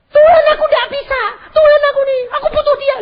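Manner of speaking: high, loud, quick wailing prayer; hammed-up begging to God; mock desperate plea. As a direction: imitate a panicking wife pleading. Mimics wife begging God.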